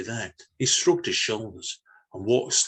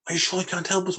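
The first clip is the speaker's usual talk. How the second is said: pleading alien; 10 semitones higher, faster than usual. Acted. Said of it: "one of the other creatures said".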